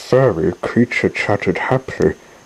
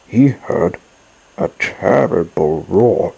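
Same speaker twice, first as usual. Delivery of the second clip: plain reading voice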